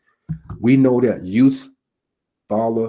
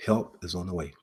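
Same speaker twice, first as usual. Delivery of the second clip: soft, lower, almost under the breath; hushed; 3 semitones lower, 10 dB quieter than usual. Retold or imitated. Preacher repeating the operator's promise to listeners, hushed and soft. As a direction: let it fall quiet like a secret comfort. Repeated quote, dropped to a hush.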